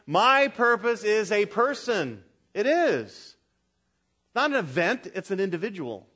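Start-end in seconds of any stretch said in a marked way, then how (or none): 0.1-2.2 slow, firm declaration; with conviction. Shown as a demonstration. Preacher leading the congregation in saying it with conviction. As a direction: say it like a creed. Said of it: "One more time, everyone".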